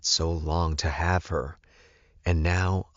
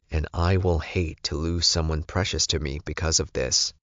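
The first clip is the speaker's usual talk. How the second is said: plain reading voice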